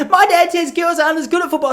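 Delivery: put-on high kid voice. Kid quoting dad.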